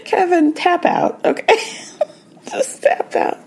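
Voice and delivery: laughing through it, the last words breathy and high. Real, not acted. To the viewers about Kevin, laughing. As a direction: crack up while telling him to quit.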